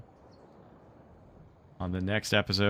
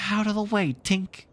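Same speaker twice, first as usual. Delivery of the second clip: put-on boy shouting; 11 semitones higher than usual. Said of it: "he shouted".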